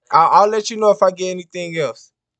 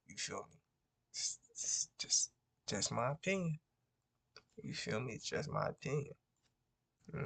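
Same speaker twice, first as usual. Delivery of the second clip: half-whispered aside; a soft, breathy confiding mutter; under his breath; 4 semitones lower, 22 dB quieter, faster than usual. Real, not acted. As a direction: mutter it just for the camera.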